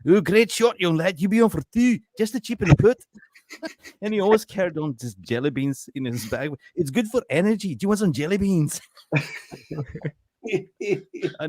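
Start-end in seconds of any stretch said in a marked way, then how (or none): 0.1-3.0 put-on high-pitched, loud old Scottish golfer; Scottish accent. Cheering on a young golfer, Scottish accent. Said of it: "he had this Scottish accent". 6.8-8.8 put-on high-pitched old Scottish golfer; Scottish accent. Old Scotsman offering sweets on the course, Scottish accent. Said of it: "he had this Scottish accent".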